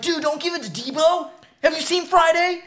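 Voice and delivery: high-pitched, incredulous warning; mock alarmed viewer. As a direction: protest in a high, worked-up voice. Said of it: "and you're like".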